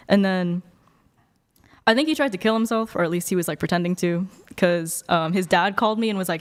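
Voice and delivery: ordinary delivery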